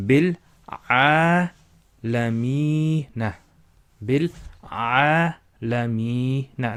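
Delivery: bil a lamina, slow with the a held long; slow recitation drill. Shown as a demonstration. Teacher leading students to repeat slowly. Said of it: "So say it with me".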